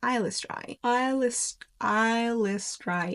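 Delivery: Eilistraee repeated carefully, slowing on the last try; practising Eilistraee's pronunciation. Shown as a demonstration. Host rehearsing the confirmed name, Eilistraee drawn out as "strai". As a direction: drill Eilistraee with "strai", stretching it out at the end. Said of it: "confirmed that it's pronounced".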